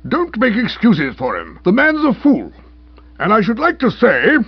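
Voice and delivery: blustering magistrate, swelling with indignation; indignant rebuke. A narrator's reading. Uncle giving the constable strong remarks, indignant. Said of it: "started to give Stilton the strong remarks".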